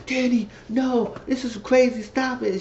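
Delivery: high-pitched mock pleading; acted protest. Acted protest of man in story.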